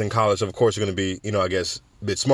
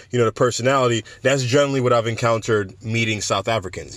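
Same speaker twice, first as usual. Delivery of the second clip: normal talking voice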